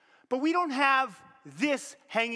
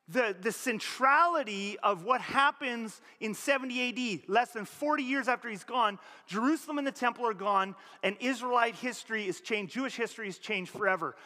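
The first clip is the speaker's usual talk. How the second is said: normal talking voice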